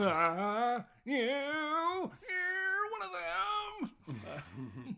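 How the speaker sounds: put-on overdone yelling of the accent line; mock yell. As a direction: drag it out as a strangled shout. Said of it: "What the fuck is this guy yelling".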